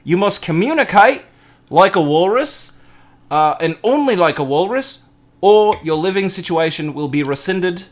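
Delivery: mock-official terms in exaggerated Australian; amateur Australian accent. Fake ad laying down walrus rules to a housemate, mock Australian accent. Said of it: "that's all I have for Australian".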